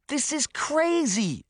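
high, drawn-out exasperated shout. A narrator's reading. Sabrina squishing through the mud, shouting in protest. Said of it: "Sabrina shouted".

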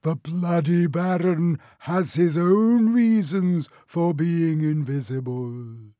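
slow, hoarse ghostly stage voice; hoarse whisper. A narrator's reading. Harry impersonates the Baron to fool Peeves, slow hoarse whisper. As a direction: intone it slowly like a haughty spectre. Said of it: "he said in a hoarse whisper".

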